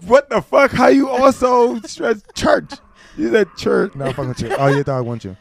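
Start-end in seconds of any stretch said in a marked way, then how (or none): 0.1-3.9 much higher, louder mock-shouted question; mock outrage. Host fakes outrage at guest's pronunciation of church, louder. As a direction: feign outrage over a word. Teasing her stress on church.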